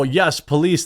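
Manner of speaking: usual speaking voice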